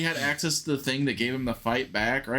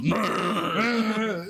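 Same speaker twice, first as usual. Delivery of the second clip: imitating Bane; 9 semitones higher than usual. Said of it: "The one that just goes".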